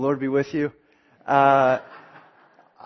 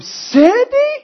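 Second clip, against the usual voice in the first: much higher, louder, slow squeak; squeaky Sandy; 9 dB louder than usual. Retold or imitated. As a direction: squeal the name like the smitten boy. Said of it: "high-pitched and squeaky".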